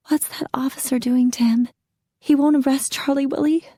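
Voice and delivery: whispered, rising to high worry; nervous wife whispering at the door; anxious whisper. A narrator's reading. Wife watching husband with police.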